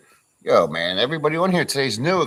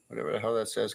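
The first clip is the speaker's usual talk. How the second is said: normal talking voice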